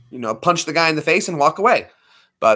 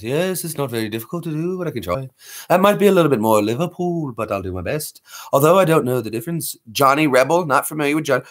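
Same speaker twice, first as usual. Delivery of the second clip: ordinary talk, nothing marked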